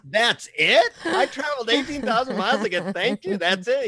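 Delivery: high-pitched comic disbelief, rising questions; incredulous protest. Retold or imitated. Traveller protesting to the guru after a long trip, incredulous.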